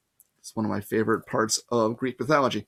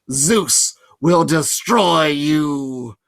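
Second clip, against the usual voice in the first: slow, louder monster threat; menacing Fury; 4 dB louder, much slower than usual. A narrator's reading. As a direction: promise doom like a demon. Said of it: "she promised".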